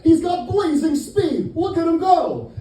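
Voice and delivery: high, loud excited commentator call; announcer losing it over a fast runner; hyped sports announcer. Pretend.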